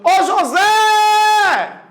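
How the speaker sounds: high shout with a very long "Zé"; calling out. Shown as a demonstration. Teacher hailing childhood friend across street, calling out. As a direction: holler "José" across the street. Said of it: "Lá do outro lado da rua".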